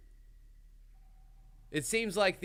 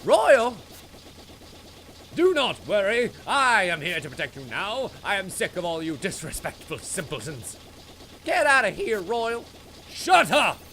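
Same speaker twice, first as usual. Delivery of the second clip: voicing game characters; 6 semitones higher, 5 dB louder than usual.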